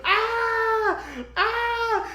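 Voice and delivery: high imitated screams, laughing at the end; mock screaming elders. Elders screaming in tandem with the girl, high mock screams. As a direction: screech along with the girl. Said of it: "village elders now screaming in tandem".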